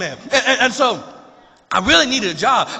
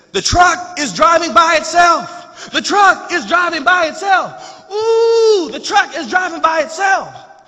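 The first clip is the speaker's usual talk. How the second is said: high, loud, drawn-out cry of amazement; wide-eyed kid shouting in wonder; amazed child; 10 semitones higher, 7 dB louder than usual. Retold or imitated. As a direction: cry it out in astonishment, pitched way up. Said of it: "He's, he's amazed".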